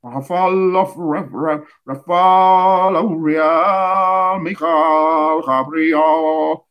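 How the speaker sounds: names stretched slow and breathy, Raphaël dragged long; sacred drawn-out chant of names; drawn-out angel names. Shown as a demonstration. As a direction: intone Raphaël, Uriel, Michael, Gabriel like a slow invocation. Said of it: "Il faut qu'on vous dise ces noms-là".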